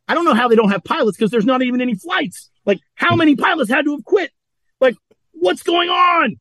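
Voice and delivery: can't believe what he's hearing; incredulous. Real, not acted. Incredulous rant about pilots.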